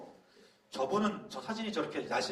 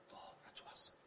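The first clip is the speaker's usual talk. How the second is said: whispered joy; 12 dB quieter than usual. A narrator's reading. Child's joy at praise.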